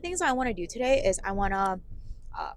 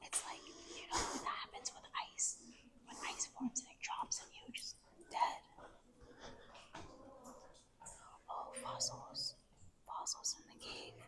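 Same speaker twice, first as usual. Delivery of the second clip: whispering; 5 semitones higher, 17 dB quieter than usual. Real, not acted. Whispering in a cave.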